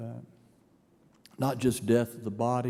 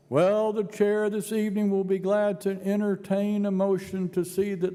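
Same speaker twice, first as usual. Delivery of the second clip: higher, louder mock-formal deadpan; tongue in cheek; 7 semitones higher, 4 dB louder than usual. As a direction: deliver the joke with a straight face. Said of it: "with his tongue in his cheek".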